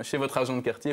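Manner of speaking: ordinary talk, nothing marked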